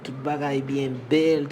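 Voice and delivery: ordinary delivery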